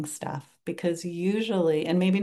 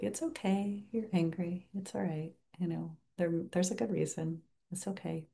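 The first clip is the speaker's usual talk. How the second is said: gentle self-comfort; 7 dB quieter than usual. Shown as a demonstration. Teacher voicing kind words to her angry self, soft and tender. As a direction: comfort yourself like a kind friend would. Nurturing oneself while angry.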